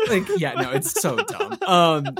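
high, loud words through laughter; laughing. Real, not acted. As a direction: talk while cracking up. Laughing after the joke.

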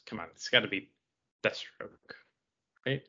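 ordinary talk, nothing marked

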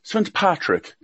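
usual speaking voice

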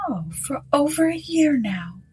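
a lady musing, drifting off; Mrs Sinclair. Acted. Hostess reproaching the district officer, high and hushed.

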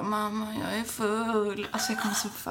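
put-on drunk confession. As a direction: mumble it like a drunk teen on the phone. Drunk call to mum. (långsam, tyst, sluddrig fyllröst)

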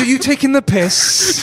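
put-on angry Welsh accent. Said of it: "easier when you're angry".

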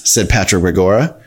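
plain reading voice

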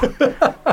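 short real laugh at himself; amused laugh. Real, not acted. A guest laughs at what people say about him. Laughs at being called stoned.

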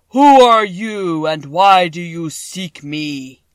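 a vast, slow, thundering wizard; voice of the great Oz. A narrator's reading. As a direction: boom each word out like a huge magic head. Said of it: "Dorothy heard a voice say".

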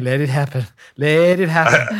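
breathy mock Bob Ross murmur; Bob Ross imitation. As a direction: lean in like a creepy painter. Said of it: "and saying".

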